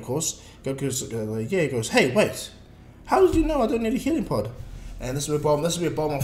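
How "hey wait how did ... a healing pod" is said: higher, a bit louder sudden suspicion; mock surprise. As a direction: catch it mid-thought, suspicious. Goku realizing in retold scene.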